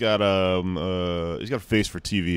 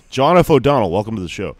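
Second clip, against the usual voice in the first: big host welcome; 3 semitones higher, 9 dB louder than usual. Real, not acted. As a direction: boom out the guest's name like a show host. Host introducing guest on show.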